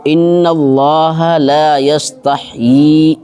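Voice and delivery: slow, drawn-out tajweed chant; Quran recitation. Shown as a demonstration. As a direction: recite each word long and measured. Recites verse before translation. (تجوید کے ساتھ آہستہ تلاوت)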